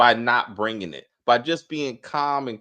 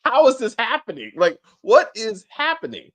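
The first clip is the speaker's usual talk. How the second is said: high, loud incredulous questions; can't believe what she's seeing; baffled disbelief; 10 semitones higher, 6 dB louder than usual. Real, not acted. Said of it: "I was confused".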